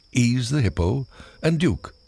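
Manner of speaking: usual speaking voice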